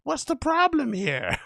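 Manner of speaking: high-pitched mock protest; high, incredulous put-on voice; mock excuse-making. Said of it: "giving you one of those ones".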